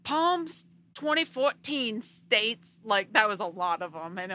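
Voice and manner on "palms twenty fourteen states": mock preachy Southern drawl, loud and slow; Southern accent. A Southern reviewer preaching a bad review in a loud drawl. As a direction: ham up a Southern man condemning the book. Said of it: "I like your accent".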